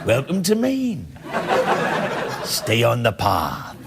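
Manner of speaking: slow, dropping Maine local voice; Maine accent. Retold or imitated. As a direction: drawl it like an old Maine local. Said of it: "folks that talk like that".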